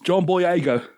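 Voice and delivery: slow mock British name; British accent impression. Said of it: "not so poshy".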